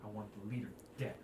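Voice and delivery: low, fast, hushed demand; Halia's quiet threat. Acted. Guildmaster to adventurers, low and fast. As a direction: lean in and say it low after checking the room. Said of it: "and she goes".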